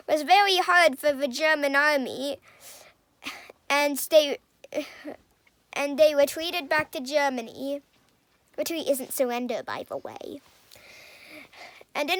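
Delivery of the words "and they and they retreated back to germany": normal talking voice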